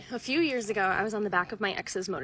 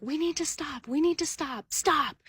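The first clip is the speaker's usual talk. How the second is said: high-pitched, urgent yell at moderate volume; urgent mock shout to stop; retold yelling; 8 semitones higher than usual. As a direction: imitate yelling in someone's ear, voice pitched up. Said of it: "I am yelling in his ear".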